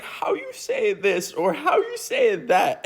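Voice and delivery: high, slow put-on Spanish accent; mock a friend's fake Spanish accent; broken English in a drawn-out Spanish accent; affected Spanish accent. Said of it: "a little bit of an accent".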